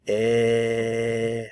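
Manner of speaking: "Eh" held long and steady; vowel demonstration. Shown as a demonstration. Teacher isolates the vowel for learners, a long held "Eh". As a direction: sustain the vowel in "Eh" on one note. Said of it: "Pay attention to the vowel sound".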